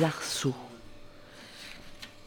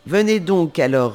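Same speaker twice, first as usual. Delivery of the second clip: louder roaring command; louder roared order; roaring queen; 7 dB louder than usual. Acted. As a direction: roar the order. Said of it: "rugit la reine".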